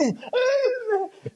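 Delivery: laughter that breaks into a squeak; high helpless laugh. Real, not acted. Laughs hard at the memory.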